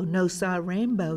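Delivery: ordinary delivery, nothing marked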